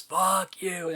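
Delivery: high-pitched, drawn-out movie quote imitation; David Caruso. Quoting a ridiculous Session Nine scene, imitated outburst. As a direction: stretch it high in mock outburst. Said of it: "David Caruso in Session nine".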